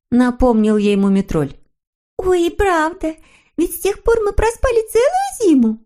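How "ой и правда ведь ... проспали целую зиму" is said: put-on very high, sweet girlish character voice; eager little girl figure. A narrator's reading. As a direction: agree gladly in a high little voice. Snork Maiden voiced in story. (тоненький девичий голосок)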